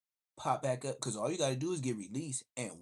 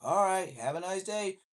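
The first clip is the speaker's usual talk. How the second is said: loud sing-song goodbye, pitched higher; mock polite send-off; officer dismissing the teen, mock polite; imitating the cop; 8 semitones higher, 7 dB louder than usual. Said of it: "then be like".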